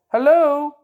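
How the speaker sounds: loud repeated hello, waiting for a reply; calling out on phone. Real, not acted. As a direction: call down the phone for someone to answer. Calling into silent phone line.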